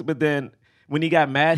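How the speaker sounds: ordinary delivery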